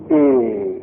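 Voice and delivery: «اه» with the sound dropping downward; downward-broken vowel. Shown as a demonstration. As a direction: let «اه» fall low, as if the sound breaks down. («اه» با صدای شکسته به طرف پایین)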